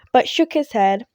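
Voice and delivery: ordinary delivery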